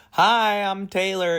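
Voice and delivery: high, loud, chirpy celebrity introduction; mock a star introducing herself in a high voice; exaggerated high-pitched greeting. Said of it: "I just saw [name]".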